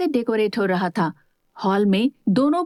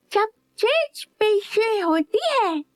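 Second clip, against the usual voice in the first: put-on much higher, slow little boy voice; eager child; 11 semitones higher, slower than usual. A narrator's reading. Little boy interrupting his uncle, eager and childlike. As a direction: cut in eagerly like a small boy.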